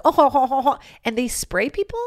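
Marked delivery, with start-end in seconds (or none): 0.1-0.8 a light French skunk laugh; mock French accent, slightly higher. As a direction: lightly chuckle like a lecherous French cartoon skunk. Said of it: "they're French... they're like".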